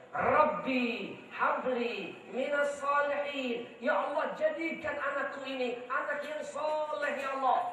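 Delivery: mother praying. Retold or imitated. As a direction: pray over the child like his mother. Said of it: "saya dibacain satu ayat di kuping".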